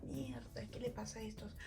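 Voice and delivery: very soft, half-whispered muttering; bewildered aside. Retold or imitated. Walking past water throwers, muttered under breath. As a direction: mutter it to yourself.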